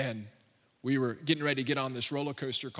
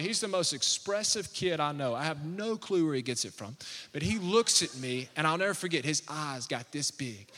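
ordinary talk

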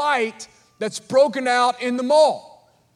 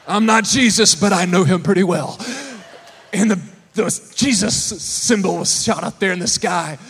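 normal talking voice

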